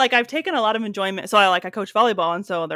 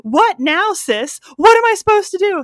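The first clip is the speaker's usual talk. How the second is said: high, exasperated what now sis; higher, louder, fed-up sass; sassy quote; 11 semitones higher, 5 dB louder than usual. Retold or imitated. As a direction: play the frustrated curly-haired girl. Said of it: "And she's like".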